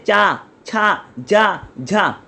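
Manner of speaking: slow, careful चा छा जा झा with tongue on the palate; palatal syllables demo. Shown as a demonstration. Teacher showing palatal sounds to students, slow and careful. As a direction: stretch out चा छा जा झा one by one. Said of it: "तालु बोलते हैं".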